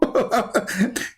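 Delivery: short high chuckle; real laughter. Real, not acted. Streamer amused that the caller hung up, high chuckle. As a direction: break into a quick high laugh.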